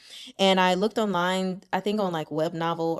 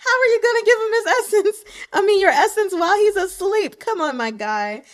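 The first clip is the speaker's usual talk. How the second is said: high, loud laughing protest; amused mock outrage; 11 semitones higher, 7 dB louder than usual. Reader berating prince over giving his essence, loud amused outrage. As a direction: berate him loudly with laughter breaking in. Playfully berating the book's character.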